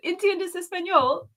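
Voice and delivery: put-on Spanish in a British accent; slow, high British-accented Spanish. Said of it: "with a British accent".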